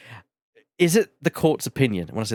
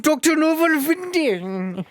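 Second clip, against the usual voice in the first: slow, high mad scientist voice; 13 semitones higher, slower than usual. Retold or imitated. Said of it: "mad scientist".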